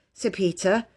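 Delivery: usual speaking voice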